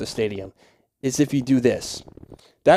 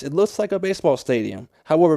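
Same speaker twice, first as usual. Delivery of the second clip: ordinary talk, nothing marked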